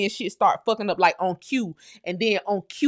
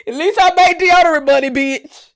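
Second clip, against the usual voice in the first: louder brag; 6 semitones higher, 7 dB louder than usual. Real, not acted.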